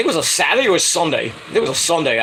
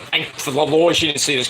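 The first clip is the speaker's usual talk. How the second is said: usual speaking voice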